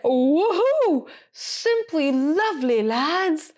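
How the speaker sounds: high, drawn-out celebration over the radio; imitated jubilant whoop. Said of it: "like, whooped on the radio".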